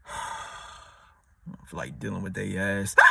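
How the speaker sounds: low, soft and drained; weary fed-up. Retold or imitated. Someone fed up with their partner, low, soft and drained. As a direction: sigh it out, done with them.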